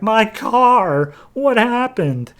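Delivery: high, loud squawk of disbelief; shocked car owner. Retold or imitated. Car owner sees his car wrecked, squawks in shock. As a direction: wail like a man who just lost his car.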